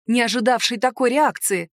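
ordinary delivery

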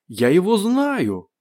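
high, very drawn-out call; shouted recognition. A narrator's reading. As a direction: call up to those above, stretching it. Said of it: "крикнул он наверх". (протяжный крик наверх)

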